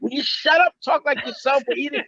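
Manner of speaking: high-pitched retold scolding; mock exasperation. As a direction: imitate fed-up brothers telling him off. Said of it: "So they hated me for that".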